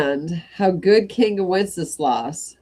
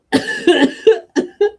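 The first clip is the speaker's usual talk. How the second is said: sudden real coughing fit; choking cough; 14 semitones higher, 7 dB louder than usual. Real, not acted. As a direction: cough hard mid-sentence. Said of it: "I'm choking".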